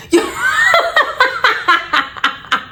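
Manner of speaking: high-pitched burst of laughter; real high laugh. Real, not acted. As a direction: crack up in disbelief. Laughing at friend's bumper.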